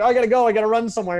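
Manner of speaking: high, loud mock hurry; fake excuse. Mocking someone who dodges a call, hammed-up hurry. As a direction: play the busy guy faking an excuse to leave.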